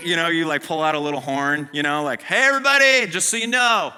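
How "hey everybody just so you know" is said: loud, high show-off call to the crowd; hammed-up boast about giving money; mock announcement.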